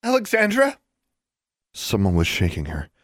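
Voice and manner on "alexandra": high, rising, anxious call; worried questioning name call. A narrator's reading. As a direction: check if your friend is conscious. Friend rousing her unconscious friend.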